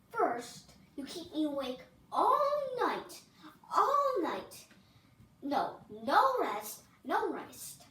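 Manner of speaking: loud, shouting princess in a rage; furious tantrum. Acted. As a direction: yell every complaint at him. The princess throws a fit.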